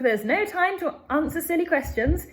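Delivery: high, loud brushing-off shout; impatient shout. Acted. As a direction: wave off the silly question loudly. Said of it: "shouted Mister Wonka".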